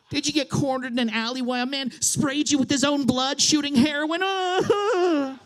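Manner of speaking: high, sarcastic fake pity rising to a wail; hammed-up outraged sympathy; mock angry taunt. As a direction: taunt in a climbing mock whine. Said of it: "I just feel myself getting angry".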